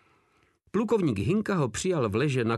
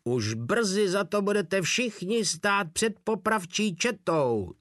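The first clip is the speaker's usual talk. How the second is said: high shout from a distance; 5 semitones higher than usual. A narrator's reading. Captain von Páder shouts back at the company while fleeing. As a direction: fling the threat back high while running off. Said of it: "zakřičel ještě".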